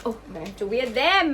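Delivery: normal talking voice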